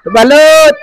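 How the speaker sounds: hawker's shout across the street; vendor's call. Real, not acted. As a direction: call out to buyers. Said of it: "sa pagsigaw ko".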